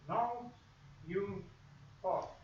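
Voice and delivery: Now, you, talk with long gaps; pause between words. Shown as a demonstration. Radio teacher showing choppy speech, pausing between each word. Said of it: "not like this, I'm making a point. I pause between words".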